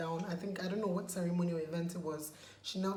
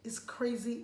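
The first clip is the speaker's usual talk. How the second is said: ordinary talk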